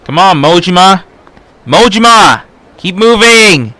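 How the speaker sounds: loud, high cheering shout; cheering yell. Real, not acted. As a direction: urge him on with a yell. Cheering character on in game.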